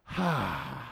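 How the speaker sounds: sigh of relief. Acted. Man who didn't give in, relieved exhaled sigh. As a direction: exhale as a weight lifts off. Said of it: "it's almost like".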